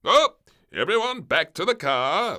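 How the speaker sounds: high, loud call to the car. Acted. The king calling everyone in from the rain, loud high call. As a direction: round them up in the rain. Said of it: "said the king".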